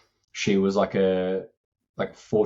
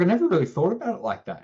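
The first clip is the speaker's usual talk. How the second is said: high, mock-surprised mum voice; imitating the mum; 10 semitones higher, faster than usual. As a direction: voice her like a dawning realisation, pitched up. Mum's voice in retold story.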